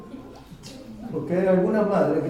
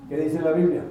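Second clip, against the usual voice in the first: ordinary talk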